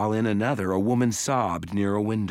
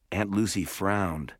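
ordinary delivery

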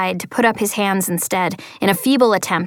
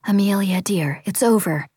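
whispering. Acted. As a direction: hush her gently. Teacher soothing sobbing girl.

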